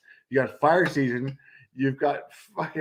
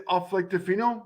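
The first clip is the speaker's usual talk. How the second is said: usual speaking voice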